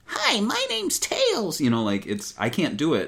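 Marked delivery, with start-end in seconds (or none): 0.1-1.6 put-on high, slow falsetto little boy voice with a little rasp; Tails. Shown as a demonstration. Podcaster demonstrating how Tails is voiced, raspy little boy voice. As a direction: squeak it out as a stock cartoon boy. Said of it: "add a little rasp to it".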